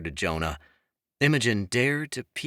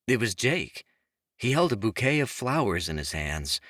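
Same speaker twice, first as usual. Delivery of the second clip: ordinary delivery, nothing marked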